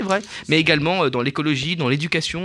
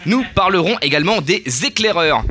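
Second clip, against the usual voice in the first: normal talking voice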